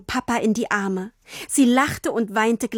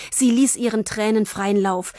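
ordinary delivery

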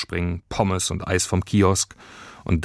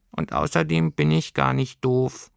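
higher voice, mildly indignant creature; squeaky know-it-all creature; 6 semitones higher than usual. A narrator's reading. Creature insists to girl it isn't stupid, squeaky. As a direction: add it pertly in creature voice.

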